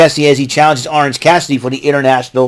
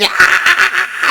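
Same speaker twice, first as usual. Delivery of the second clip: mock scream. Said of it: "He says".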